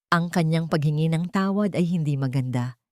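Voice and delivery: plain reading voice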